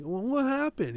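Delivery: lightly acted waking-up confusion. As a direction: imitate someone coming to, pitched well up. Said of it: "just had him waking up".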